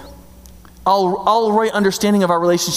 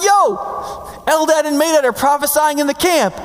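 high-pitched tattling voice; excited young guy; 10 semitones higher than usual. Retold or imitated. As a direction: blurt it out like a kid running in with gossip. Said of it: "he's like, he's like".

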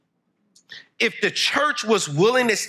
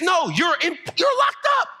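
preaching shout. Real, not acted. Preacher shouting what scripture calls debtors, full force. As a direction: thunder the verdict at the crowd.

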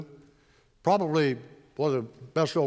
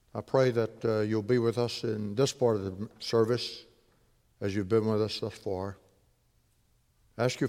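hushed, reverent prayer; 3 semitones lower, 3 dB quieter than usual. Acted. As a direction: pray softly and humbly to God. Pastor leads opening prayer.

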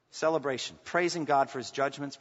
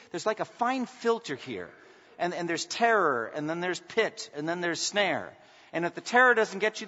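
louder, insistent pulpit thunder; preaching at full force; 5 semitones higher, 7 dB louder than usual. Acted. Preacher warning the congregation there is no escape, forceful. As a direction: hammer the judgment home, voice raised.

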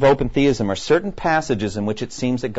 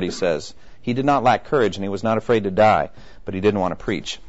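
normal talking voice

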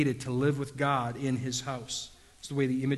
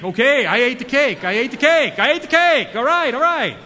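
loud, much higher caving-in cry; exasperated confession; 9 semitones higher, 11 dB louder than usual. Retold or imitated. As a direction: blurt it out as you give up.

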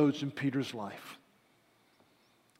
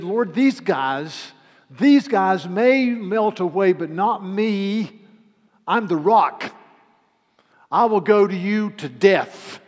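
loud, higher, slow boasting; big, drawn-out boastful proclamation; arrogant bravado; 5 semitones higher, 9 dB louder, slower than usual. Acted. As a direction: declare it with swaggering disdain. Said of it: "with a sense of bravado and arrogance and disdain".

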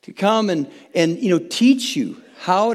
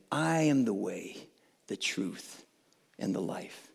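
hushed quote; 10 semitones lower, 13 dB quieter than usual. Acted. Reverent scripture quote.

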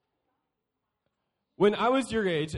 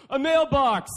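put-on higher, louder childlike blurt; proud kid answer; 7 semitones higher, 9 dB louder than usual. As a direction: announce it like a proud little kid. Kid answering parents in skit.

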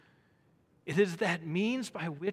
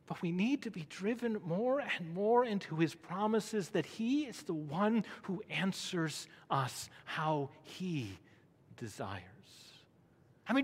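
ordinary delivery

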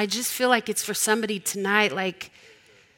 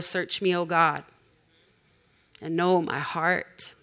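scripture reading; slower than usual. Acted.

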